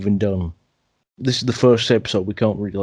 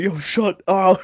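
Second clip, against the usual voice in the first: higher-pitched mock yell; half-hearted shout in a raised pitch; mock screaming; 8 semitones higher, slower than usual. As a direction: imitate yelling at a friend to shut up. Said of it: "We're, like, screaming".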